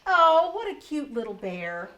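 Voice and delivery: cooing over a cute bear; gushing visitor. Acted. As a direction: coo at the koala. Reading a visitor's gushing line.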